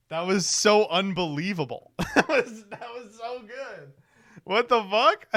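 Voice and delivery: high-pitched, laughing disbelief; real laughing amazement. Real, not acted. Streamer floored by a fan skit, laughing in disbelief. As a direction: crack up while trying to praise it.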